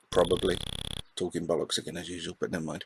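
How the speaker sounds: low, soft, quick grumble to yourself; throwaway low mumble; muttered aside. Real, not acted. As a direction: mutter it under your breath, self-deprecating. Muttered aside about own talk.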